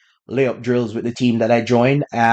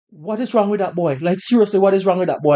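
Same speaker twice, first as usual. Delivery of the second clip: higher-pitched retelling of a crying father; imitated tearful dad; 6 semitones higher, faster than usual. Shocked dad crying over his son, mildly imitated tearful voice. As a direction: imitate a dad upset to tears, voice raised in pitch. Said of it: "he started crying and he's like".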